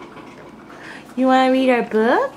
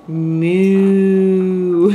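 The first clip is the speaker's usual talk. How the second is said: cow moo; 9 semitones lower than usual. Shown as a demonstration. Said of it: "What does the cow say?".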